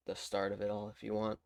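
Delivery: ordinary delivery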